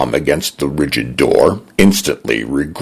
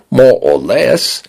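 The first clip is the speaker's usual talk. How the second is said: Cockney-leaning British; 7 semitones higher, slower than usual. Acted. Condo's British voice.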